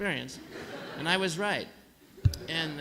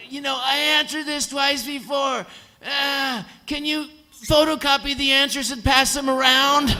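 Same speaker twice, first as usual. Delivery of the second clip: loud, high exasperated outburst; irritated yelling at the staff; fed-up shout; 9 semitones higher, 9 dB louder than usual. Retold or imitated. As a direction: snap back fed up with repeating yourself. Fed up with repeated hospital questions.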